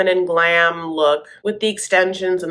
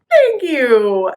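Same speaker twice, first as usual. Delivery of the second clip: mock thank you; 6 semitones higher, 6 dB louder than usual. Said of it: "it's like".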